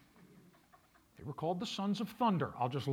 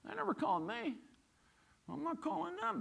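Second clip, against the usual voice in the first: higher, mock-sulky whine of a hurt churchgoer; sulky complaint; 8 semitones higher than usual. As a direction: whine it like someone waiting to be called. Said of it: "their primary reason is".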